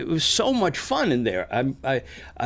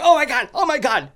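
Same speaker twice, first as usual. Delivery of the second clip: much higher, loud frightened exclaiming; panicked cries; 10 semitones higher, 6 dB louder than usual. Retold or imitated. Rude guy fleeing in panic, loud frightened cries. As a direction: cry out in alarm. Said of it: "he's going".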